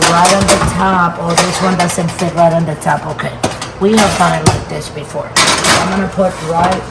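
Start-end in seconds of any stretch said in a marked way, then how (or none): none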